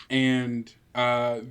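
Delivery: ordinary talk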